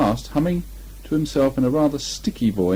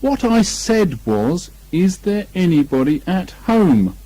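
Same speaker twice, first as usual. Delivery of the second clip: slow, loud and high; very loudly; 6 semitones higher, 5 dB louder, slower than usual. Acted. Bear repeats his call down the hole, very loud. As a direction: shout it slowly so he hears. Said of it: "called out Pooh very loudly".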